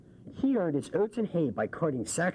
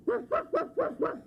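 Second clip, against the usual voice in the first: high woofs breaking into a laugh; dog barking. Pretend. Child barks for the dog, laughing. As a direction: bark like a puppy, giggling. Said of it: "The dog barked".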